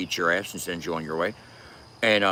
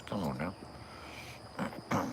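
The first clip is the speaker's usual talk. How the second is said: very slow whispered aside; breathy whisper; 4 semitones lower, 15 dB quieter, much slower than usual. Real, not acted. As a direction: let it fade out on a whisper. Dismissing N-word in prison question.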